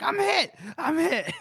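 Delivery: high, loud hammed-up yelp of a wounded soldier; mock panicked cry. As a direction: cry out as if shot, played for laughs. Worth panics under fire.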